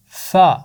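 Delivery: فا said short and punchy, a bare fa; short f sound. Shown as a demonstration. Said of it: "اس کی آواز ہے".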